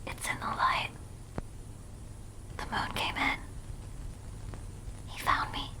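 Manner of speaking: eerie, hushed horror whisper. Acted. Streamer reading scary video text, whispering. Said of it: "The whispering voice goes crazy".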